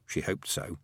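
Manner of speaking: ordinary delivery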